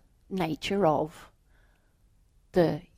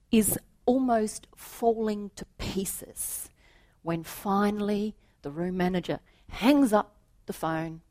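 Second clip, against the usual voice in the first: usual speaking voice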